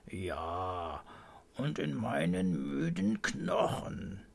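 slow, drowsy grandpa mumble; tired old man mumbling slowly; sleepy babbling. A narrator's reading. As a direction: babble on half asleep, dragging the words. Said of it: "brabbelt Opa".

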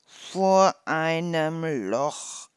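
slow, loud, drawn-out tease; giggling tease. A narrator's reading. Hedgehog warns mouse of the hole, drawn-out teasing. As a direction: stretch each word out teasingly. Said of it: "Der Igel kicherte".

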